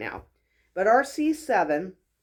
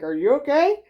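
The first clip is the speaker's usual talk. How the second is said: concerned onlookers; 8 semitones higher, 8 dB louder than usual. Retold or imitated. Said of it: "the people around me are like".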